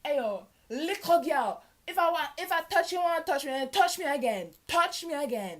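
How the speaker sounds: loud Jamaican patois taunt; mock patois threat. Sophomore warning the senior in loud Jamaican patois. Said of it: "I'm like".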